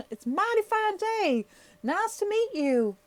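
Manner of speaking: very high, loud puppet voice with a Texan twang; Elmo. Acted. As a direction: squeal a Texan greeting as a baby puppet. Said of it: "he thinks he's from Texas".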